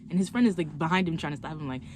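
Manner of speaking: normal talking voice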